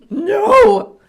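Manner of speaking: put-on high, loud child yell; child's loud no. Mom imitating daughter's shouted refusal. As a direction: bark a defiant kid's no.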